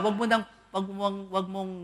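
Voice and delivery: normal talking voice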